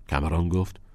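usual speaking voice

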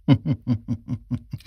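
a short soft chuckle; soft laugh. Acted. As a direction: chuckle lightly to yourself. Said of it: "دالن خنده زنان ادامه داد".

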